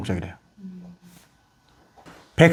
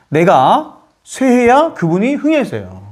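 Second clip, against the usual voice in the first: usual speaking voice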